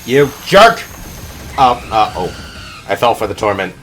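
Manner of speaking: normal talking voice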